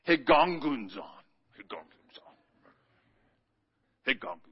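Higongunzon said low, like a grumble; grumbling Greek word. Shown as a demonstration. Pastor teaching the congregation the Greek word, low grumble. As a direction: growl out Higongunzon as a complaint. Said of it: "sounds like grumbling".

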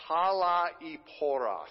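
talaiporos said slowly, syllable by syllable; talaiporos, each syllable clipped apart and drawn out; staccato. Shown as a demonstration. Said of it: "look at that word wretched".

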